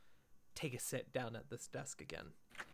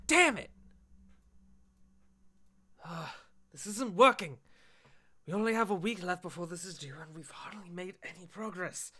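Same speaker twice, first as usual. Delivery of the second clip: exasperated outburst, loud and higher; Adam's frustrated outburst; 6 semitones higher, 10 dB louder than usual. Acted. Adam despairing over work due in a week, frustrated outburst. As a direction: blow up in frustration at being stuck. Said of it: "he slams them down and he goes".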